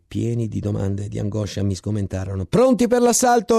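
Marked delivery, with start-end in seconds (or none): 2.4-3.6 high, loud captain's order; shouted order. Acted. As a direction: bark the question at the men. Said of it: "rispose ancora il capitano". (ordine gridato dal capitano)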